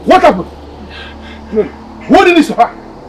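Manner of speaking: loud, high cry of disbelief; shocked outcry. Acted. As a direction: demand answers in shock. King shocked by daughter's death.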